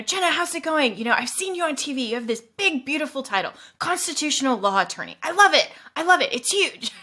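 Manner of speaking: amateur mock presidential boasting; Donald Trump impression. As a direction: lay on the superlatives like a showman. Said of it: "I can't do the impersonation as well as you".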